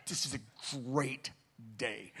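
exhausted, contented murmur; wrung out but happy. Retold or imitated. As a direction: savour each word, spent and quiet. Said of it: "Wrung out, but happy".

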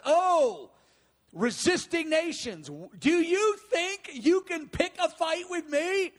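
high, mocking challenge to the nations; divine sarcasm. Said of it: "it's a divine sarcasm".

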